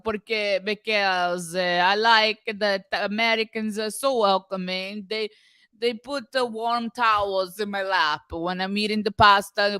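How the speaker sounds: badly faked Italian English; horrible fake Italian accent. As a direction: put on a phony Italian accent. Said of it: "putting on a horrible fake Italian accent".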